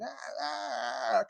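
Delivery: high-pitched "ah, ah" cries, held back in level; hysterical crying wail. Retold or imitated. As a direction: imitate a child's hysterical crying. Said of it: "I was hysterical".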